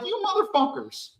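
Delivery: high, cracking disbelief; cursing the agents who went through his life; incredulous curse. Retold or imitated. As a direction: spit it out in high pitched shock. Retold outrage at FBI.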